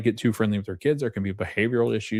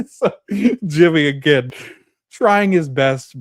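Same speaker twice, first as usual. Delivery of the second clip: laughing; 6 semitones higher, 7 dB louder, slower than usual. Real, not acted. Laughing at the fishing hat joke.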